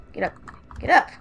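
loud shout at him; yelling. Real, not acted. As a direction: bark it loud. Yelling at sleeping monster.

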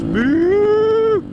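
high, loud mock moo at the cows. Rider mooing at the cows, playful imitation.

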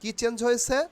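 normal talking voice

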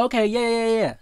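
high, bright-eyed okay yeah yeah; eager agreement. Retold or imitated. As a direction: play the eager listener lapping it up. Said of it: "They're gonna be like".

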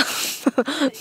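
short breathy giggle; a quick soft chuckle; soft laugh. Real, not acted. Laugh after no money.

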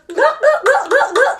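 very high squeaky frog croaks; high lady frog voice; missus frog croak. Pretend. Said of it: "she goes".

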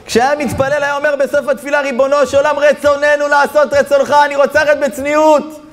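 sustained high, impassioned preaching; preaching at full force. Real, not acted. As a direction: proclaim it high and relentlessly to the crowd. (דרשה נלהבת בקול גבוה)

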